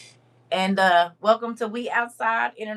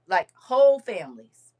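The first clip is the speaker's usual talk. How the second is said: normal talking voice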